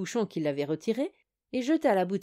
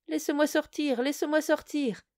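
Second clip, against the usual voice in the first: muffled voice; 7 semitones higher than usual. Acted. Voice trapped under the oak.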